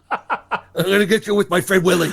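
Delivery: playful Brooklyn tough, half laughing; Brooklyn accent. Pretend. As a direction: joke threat in a pretend Brooklyn accent, through laughter. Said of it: "not in a Brooklyn accent".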